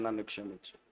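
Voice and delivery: hushed plea. Acted. Preacher voicing a prayer for the church, hushed. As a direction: let the plea sink to a hush.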